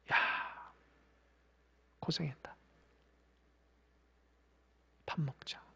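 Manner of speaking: soft, low, hushed comfort; tender whisper. Acted. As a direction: comfort tired disciples in a near whisper. Jesus voiced comforting disciples. (낮고 부드럽게 속삭이듯 위로)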